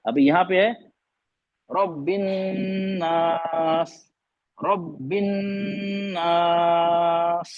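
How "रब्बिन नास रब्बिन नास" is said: slow "रब्बिन नास" with a long humming n and drawn-out aa; Tajweed ghunna recitation. Shown as a demonstration. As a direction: hold a nasal hum on the n of रब्बिन, then stretch नास. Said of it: "निकाली, गुन्ना किया".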